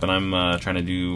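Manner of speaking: ordinary talk, nothing marked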